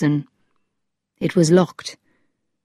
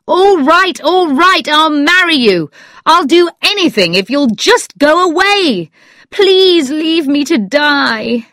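high, wailing plea, slowing at the end; desperate cry; 10 semitones higher, 4 dB louder than usual. A narrator's reading. Weak, sick man giving in to her, crying out. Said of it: "weak man cried out".

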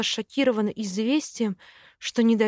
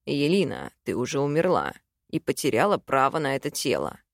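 sighing, low Simon; 8 semitones lower than usual. A narrator's reading. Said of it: "вздохнул Саймон".

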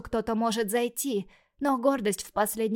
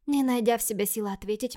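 plain reading voice

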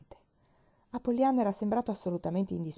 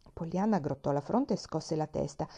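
ordinary delivery

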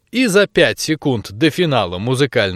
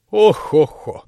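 hammed-up granny sigh; a stagey oh-ho-ho; mock old groan; 3 semitones higher, slower than usual. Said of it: "на всякий случай добавил". (притворное старческое оханье)

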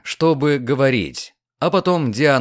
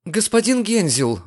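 high surprised cry of his name; startled exclamation; 7 semitones higher than usual. Acted. Diana startled by Hänsel's proposal, exclaims. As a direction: caught off guard, call out his name. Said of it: "воскликнула Диана".

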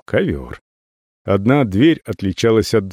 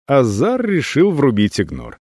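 ordinary delivery

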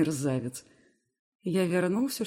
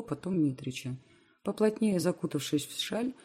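ordinary delivery

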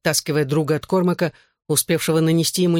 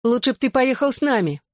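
high-pitched, gently insistent urging; pressing; 8 semitones higher than usual. A narrator's reading. Girl wants the narrator to come along, gently pressing. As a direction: keep nudging with lifted pitch. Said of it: "напирала Кэти".

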